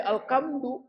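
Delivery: "alkamdu" with hard k for h; ha jadi K. Retold or imitated. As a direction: say "alkamdu", h turned into k. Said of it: "Ha jadi K". ("alkamdu", huruf ha diucapkan ka)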